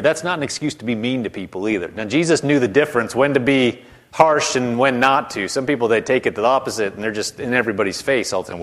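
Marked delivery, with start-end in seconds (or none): none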